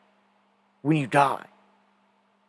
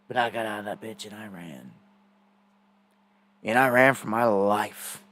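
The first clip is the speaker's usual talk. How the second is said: hushed recollection; 7 semitones lower, 5 dB quieter than usual. Real, not acted. Storyteller recalling how he ran for his life, hushed. Dramatic climax of escape story.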